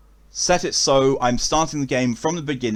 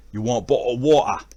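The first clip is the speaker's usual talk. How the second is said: Cockney accent. Shown as a demonstration. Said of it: "go massively over the top, um, Cockney".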